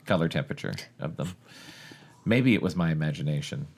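ordinary talk

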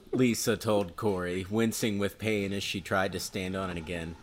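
normal talking voice

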